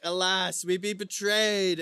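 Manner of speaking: King Arthur. Retold or imitated. The king laments to his companions, theatrical cry. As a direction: declaim it slowly like a dismayed king. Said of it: "King Arthur's like".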